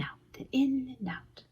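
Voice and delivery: soft whispered in and out. A narrator's reading. Reading to children, needle going in and out whispered. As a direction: hush it like careful sewing.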